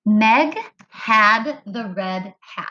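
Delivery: slow, evenly spaced words; slow modeled reading. Shown as a demonstration. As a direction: read each word slowly for counting. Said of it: "It said".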